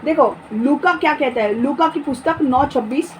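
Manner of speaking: ordinary delivery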